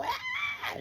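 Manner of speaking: high piercing retold scream. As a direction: let out a sudden scream. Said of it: "I screamed out".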